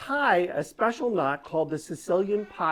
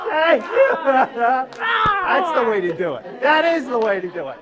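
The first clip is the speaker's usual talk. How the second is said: excited cheer; 5 semitones higher, 6 dB louder than usual. Real, not acted. Cheering after the trick.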